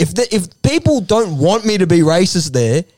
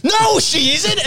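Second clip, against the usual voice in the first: mock shouted denial; 10 semitones higher, slower than usual. Retold argument, Doug denying.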